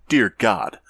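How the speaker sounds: ordinary talk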